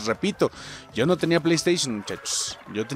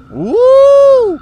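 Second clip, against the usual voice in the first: startled whoop; 11 dB louder than usual. Real, not acted. Startled by a bonus.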